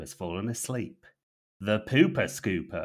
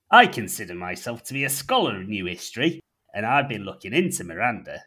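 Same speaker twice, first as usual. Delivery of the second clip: put-on North Yorkshire accent; 8 dB louder than usual. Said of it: "in his gruff North Yorkshire tone".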